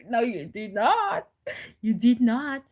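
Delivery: retold scream. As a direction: throw her scolding back, loud and a little hammed-up. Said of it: "She was screaming at me".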